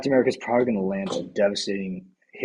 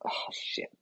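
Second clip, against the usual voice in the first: breathless, stunned whisper; a soldier whispering over a body he just killed; horrified whisper; 2 semitones lower, 7 dB quieter than usual. Retold or imitated. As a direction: whisper it in shock. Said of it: "Captain America's gonna be like".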